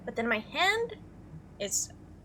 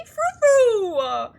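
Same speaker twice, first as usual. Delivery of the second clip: high cry fading from a shout; dismayed pleading; 8 semitones higher, 10 dB louder, much slower than usual. Real, not acted. Player begging Frou Frou to behave after the bite, high dismayed plea.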